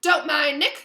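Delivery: loud, high hearty call; shouted. A narrator's reading. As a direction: shout it breezily to the group. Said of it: "shouted Sir Patrick".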